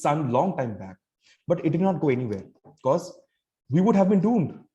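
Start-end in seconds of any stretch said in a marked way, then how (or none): none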